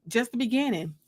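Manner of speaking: usual speaking voice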